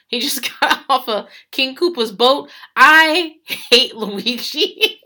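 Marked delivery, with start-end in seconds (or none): 2.8-5.0 loud, drawn-out words breaking into laughter; laughing exasperation. Real, not acted. Podcaster venting about Luigi, slow and loud through laughter.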